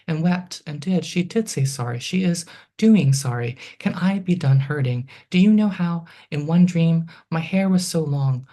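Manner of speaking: measured, rhythmic poem delivery; poetry reading. Acted. Poet reading her own poem to the audience, rhythmic reading voice. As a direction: read it like a poet on stage.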